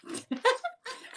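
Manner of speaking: a high, bright burst of laughter; real laugh. Real, not acted. Reader laughs at drawing her own name, real laugh. Laughs at being drawn herself.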